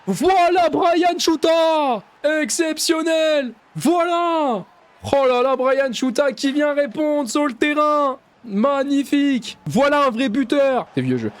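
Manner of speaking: fast, excited French match commentary; live play-by-play. Acted. Gamer calling his own match as commentator, excited play-by-play. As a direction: call the attack like a TV commentator.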